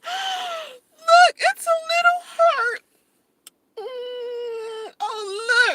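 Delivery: very high, crying-out delight; delighted gasp. Real, not acted. Vlogger finding a heart-shaped fry, high delighted cry. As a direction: gasp with touched joy.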